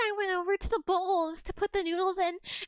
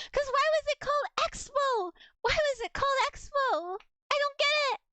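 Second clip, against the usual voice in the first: higher-pitched, worked-up comic rant; exasperated bafflement; 5 semitones higher than usual. Real, not acted. Worked-up rant about the word expo.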